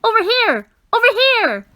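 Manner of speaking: high, loud beckoning call. A narrator's reading. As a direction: wave them over with your voice. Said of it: "advised the voice".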